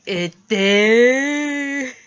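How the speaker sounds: drugged loopy voice. Retold or imitated. Telling mom in the ER, loud and loopy on meds. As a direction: play it dopey and spaced-out from the shot.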